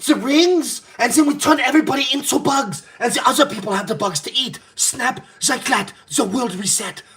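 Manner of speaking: imitating Schwab as Thanos, rising hammed-up; mock Thanos villain. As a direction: play a half-hearted Thanos scheming. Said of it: "I'm like".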